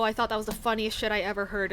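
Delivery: ordinary talk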